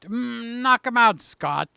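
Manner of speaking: ordinary talk, nothing marked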